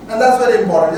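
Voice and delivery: ordinary talk, nothing marked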